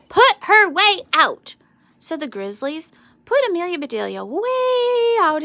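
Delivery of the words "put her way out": high, loud angry shout. A narrator's reading. Angry Tornadoes shouting to move Amelia Bedelia, loud and high. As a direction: yell it at the other team. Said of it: "they shouted".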